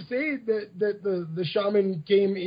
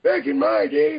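put-on old person voice; 5 semitones higher, 3 dB louder, faster than usual. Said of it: "Do I have to do the whole thing in the old".

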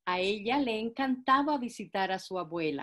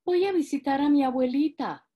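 put-on little girl voice; 5 semitones higher, 6 dB louder than usual. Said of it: "dijo Caperucita Roja".

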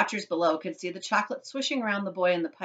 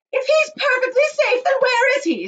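high, loud shrieking demand; angry snap; 11 dB louder than usual. A narrator's reading. Mother demands son's whereabouts, angry snapping. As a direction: snap it at full pitch. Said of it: "snapped Missus Gloop".

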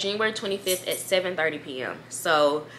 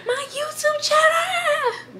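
very high, drawn-out wail; slow, high, broken sob; crying wail; slower than usual. Retold or imitated. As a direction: wail it to the boyfriend through tears. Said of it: "just started crying".

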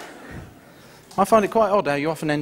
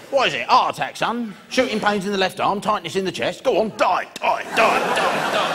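gleeful father-in-law; 6 semitones higher than usual. Retold or imitated. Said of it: "He's like".

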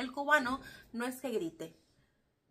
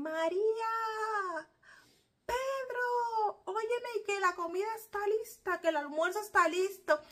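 high, loud call across the neighborhood; imitating a Cuban grandmother hollering from afar; mother calling out; 10 semitones higher, 4 dB louder than usual. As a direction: yell for the kids to come eat. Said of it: "te meten el grito desde una punta a la otra".

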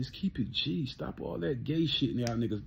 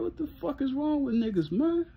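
ordinary talk

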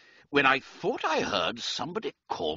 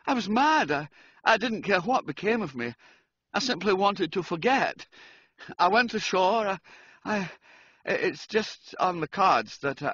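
nerves on end, everything pitched up; jumpy George; 7 semitones higher than usual. A narrator's reading. George telling Reggie about last night, high and rattled.

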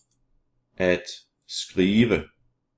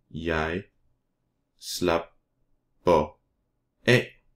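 "slapper af" broken into slap, per, af with long pauses; syllable by syllable; slower than usual. Shown as a demonstration. Teacher models Danish verb for learners, syllable by syllable. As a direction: say "Jeg slapper af" very slowly, each syllable apart.

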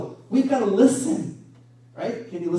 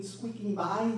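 ordinary delivery

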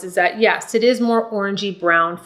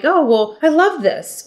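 delighted; 6 semitones higher than usual. Retold or imitated. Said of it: "glide into these decades like".

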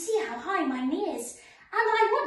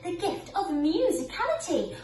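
gentle storybook narration; reading to children; 4 semitones lower than usual. Acted. Reads Sleeping Beauty to children.

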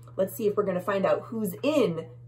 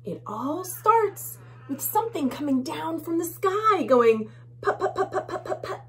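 high, wondering spaceship sounds; dramatic story reading; 9 semitones higher than usual. A narrator's reading. Reader to children, voicing a spaceship coming down. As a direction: build suspense for the little ones.

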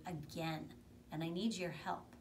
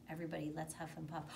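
ordinary delivery, nothing marked

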